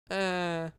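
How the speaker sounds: mummy drone as a text alert; one toneless mechanical "uh"; mummy groan imitation. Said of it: "gonna be my texting tone".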